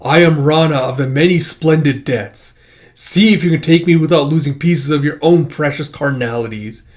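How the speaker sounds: loud, theatrical warrior's boast; defiant challenge. Acted. Rana taunting the monsters before battle, defiant challenge. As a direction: proclaim your name and dare them.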